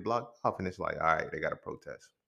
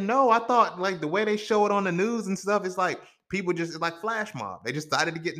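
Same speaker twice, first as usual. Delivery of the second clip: surprised; 9 semitones higher than usual. Retold or imitated. Retold shock at scheduled protests.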